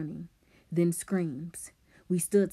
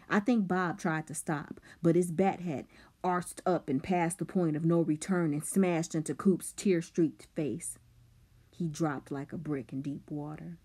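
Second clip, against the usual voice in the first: usual speaking voice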